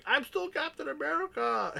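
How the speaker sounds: high, strained whine; whiny high-pitched quote. Retold or imitated. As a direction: squeak it out like a sore loser. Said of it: "you can hear him going".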